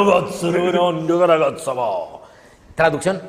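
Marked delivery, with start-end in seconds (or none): 0.0-1.7 mock Japanese. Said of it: "todas las japonesas son".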